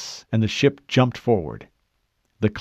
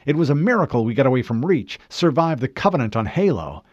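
higher, incredulous voice building in force; protesting crew member; 5 semitones higher than usual. Acted. Crew member challenging the Chief's mission, incredulous protest. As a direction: object in disbelief, gathering heat.

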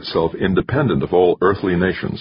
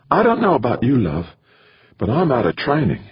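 much higher female character voice; woman's voice; 8 semitones higher than usual. A narrator's reading. Woman to companion about being out of training, high female voice. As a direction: pitch it high as her reply.

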